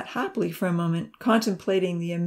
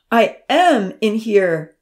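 slow, much louder call through the door; calling out loudly; 4 semitones higher, 9 dB louder, much slower than usual. A narrator's reading. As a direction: call out so they hear you. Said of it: "Amy called out loudly".

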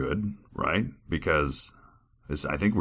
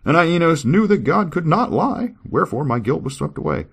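higher, louder scripture reading; 13 semitones higher, 7 dB louder than usual. Acted. Reader voicing Enos's joy at forgiveness, proclaiming. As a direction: read it out high and loud, proclaiming.

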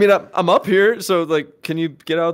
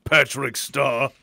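menacing avenger; 11 semitones lower, 7 dB quieter than usual. Acted. Hero threatening Patrick over stolen Molly, low grave menace. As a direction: intone it like a dark, solemn vow. Hero's grand threat to villain.

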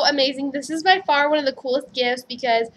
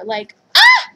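high, loud happy squeal; little squeal; 13 semitones higher, 10 dB louder than usual. Real, not acted. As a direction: squeal of delight. Gushing about the gift.

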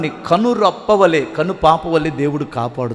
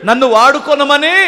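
ordinary delivery

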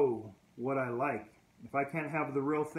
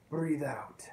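whispered exhale. Retold or imitated. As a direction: breathe out breathed as a whisper. Breathing instruction.

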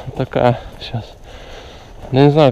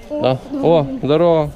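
normal talking voice

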